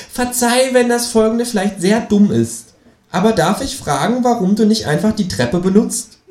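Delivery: kangaroo voice. Acted. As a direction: feign politeness as the kangaroo. The kangaroo speaks. (hohe, gespielt höfliche Känguru-Stimme)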